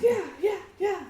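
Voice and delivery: high, limp yes-man yeahs; mocking a pushover. Mocking a weak boyfriend who agrees to everything. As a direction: mimic a spineless agreeing partner. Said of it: "a pussy ass dude".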